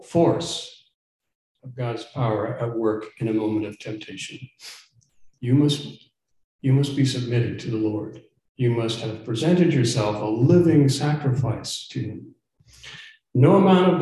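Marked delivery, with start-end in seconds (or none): none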